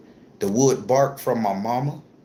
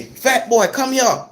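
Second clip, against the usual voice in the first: put-on high, loud call across the backyard, Fat boy; 9 semitones higher, 3 dB louder, slower than usual. Grandma and auntie call the boy over to the pot, high loud call. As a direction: summon the boy like an old auntie yelling.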